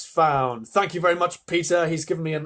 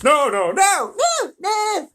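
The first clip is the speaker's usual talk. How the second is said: loud, high chorus of refusals; insistent high-pitched outcry; crying out in protest; 12 semitones higher, 8 dB louder, slower than usual. Acted. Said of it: "the others all cried".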